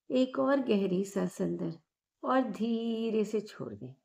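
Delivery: calm, unhurried meditation guide with long pauses; measured, soothing guidance, drawing out the breathing cues; guided meditation. Acted. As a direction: guide them gently inward, phrase by phrase.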